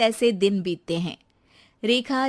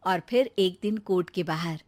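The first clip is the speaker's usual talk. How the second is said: ordinary delivery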